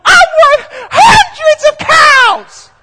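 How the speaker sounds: very high, slow, shouted sing-song; yelling at the top of her lungs. Retold or imitated. Daughter shouting her worth to the food crowd, loud and high. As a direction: yell it to the whole street in delight. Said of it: "She yells out at the top of her lungs".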